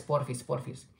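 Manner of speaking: ordinary talk, nothing marked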